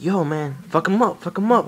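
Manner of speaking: high, excited egging-on; sister egging him on. Retold or imitated. Sister cheering from the side, high and excited. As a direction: hype him up to fight, pitched high. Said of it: "And my sister's like".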